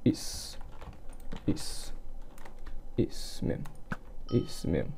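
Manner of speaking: unvoiced hushed whisper. Real, not acted. Gamer whispering to himself in a tense fight.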